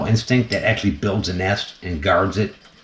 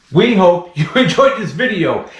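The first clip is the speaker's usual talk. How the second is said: higher sign-off with a laugh in the middle; 8 semitones higher than usual. Real, not acted. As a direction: try to finish the outro but crack up. Laughing sign-off, second try.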